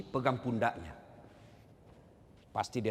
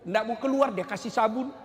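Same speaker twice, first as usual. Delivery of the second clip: ordinary talk, nothing marked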